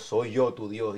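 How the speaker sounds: low, hushed divine voice; voice of God. Acted. God answers the startled lady, voice from heaven. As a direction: answer deep and soft, playing God. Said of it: "Y dice la voz".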